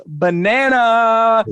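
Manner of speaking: slowly stretched-out announcement; triumphant, elongated proclamation; drawn-out reveal. Real, not acted. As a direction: draw the word right out for the big reveal. Triumphant reveal of the predicted word.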